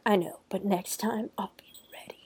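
hushed, whispered mock threat; softly whispered comeback, acted out; whispered warning. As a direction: drop to a whisper for a dramatic retold threat. Character's defiant parting threat, retold.

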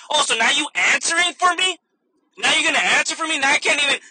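loud, high indignant customer; mock outrage. Customer snaps at cashier for answering for her, mock outrage. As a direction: imitate a customer blowing up.

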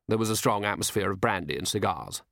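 usual speaking voice

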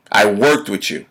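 ordinary delivery

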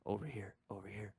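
fading to a whisper; eerie whispered mimic call. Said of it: "adapt other people's voices".

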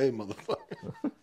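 playful high-pitched dog voice. Pretend. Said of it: "he's like".

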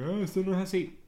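high scoffing objection; skeptical film buff. Retold or imitated. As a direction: scoff like a know-it-all cinephile. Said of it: "que debe estar como que".

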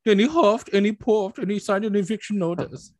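high, German-accented cartoon pig; three little pigs. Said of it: "do the line from Shrek".

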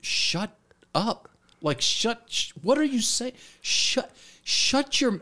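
high, urgent voice in the head; high-pitched comic self-reproach; panicked inner voice. Retold or imitated. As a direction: imitate your own brain scolding you. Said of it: "this voice starts, like, going off in my brain".

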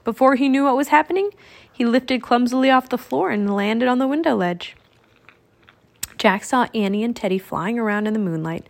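ordinary delivery

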